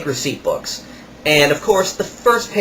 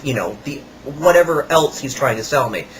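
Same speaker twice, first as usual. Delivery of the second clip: ordinary delivery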